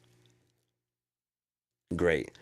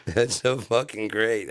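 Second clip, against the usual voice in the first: ordinary delivery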